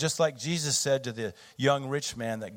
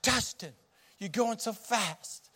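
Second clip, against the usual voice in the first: much higher, slow anxious plea; nervous plea; 9 semitones higher than usual. Retold or imitated. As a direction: drag it out like a nervous passenger. Scared dad pleads with son.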